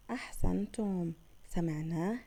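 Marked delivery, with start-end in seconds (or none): none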